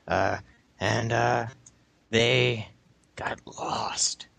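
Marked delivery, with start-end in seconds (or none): 2.1-4.3 whispered ghost-story voice; spooky whisper. Shown as a demonstration. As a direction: hush it like a campfire tale. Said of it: "You'd have to talk like this".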